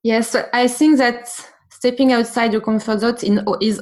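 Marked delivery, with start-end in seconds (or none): none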